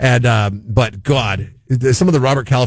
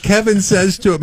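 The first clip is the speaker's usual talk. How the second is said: ordinary delivery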